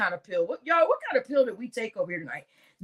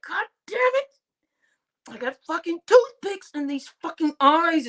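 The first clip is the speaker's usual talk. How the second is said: high, strained complaining voice; 6 semitones higher than usual. Retold or imitated. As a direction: groan it like you can't keep your eyes open.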